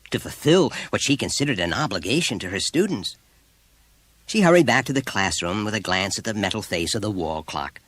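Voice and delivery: usual speaking voice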